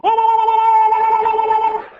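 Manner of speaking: sudden high shout; loud stadium holler; football yell. Shown as a demonstration. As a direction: bellow it like a fan in the stands. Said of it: "you go".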